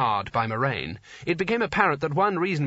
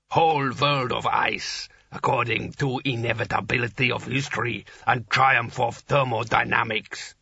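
giant rumbling voice; 2 semitones lower than usual. A narrator's reading. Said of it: "rumbled the giant".

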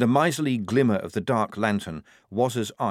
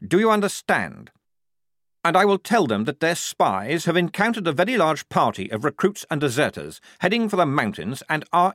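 higher, insistent, each question pressed harder; pointed scolding; 7 semitones higher, 4 dB louder than usual. Acted. Lieutenant rebuking the sergeant in front of the squad, insistent. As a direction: drive the point home again and again.

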